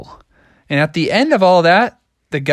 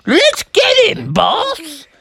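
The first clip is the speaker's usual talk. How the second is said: high, drawn-out ogre henchman; much slower than usual. Acted. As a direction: urge the boss on as a cartoon ogre. Ogre voiced in story.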